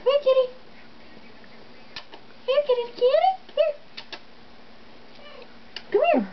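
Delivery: high sing-song call to a cat; calling a lost pet. Real, not acted. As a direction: call kitty, high and bright.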